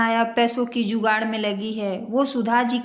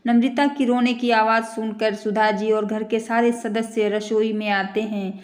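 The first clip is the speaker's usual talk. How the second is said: usual speaking voice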